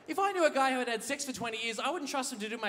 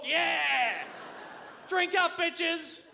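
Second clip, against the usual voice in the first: rowdy toast; 7 semitones higher, 4 dB louder, much slower than usual. Acted. Toasting the hook-handed birthday boy.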